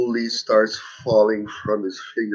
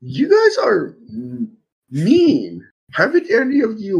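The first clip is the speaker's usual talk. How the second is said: high, drawn-out mock whimper; a put-on whine; 11 semitones higher, 5 dB louder than usual. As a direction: pretend to be hurt, whining. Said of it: "complaining playfully".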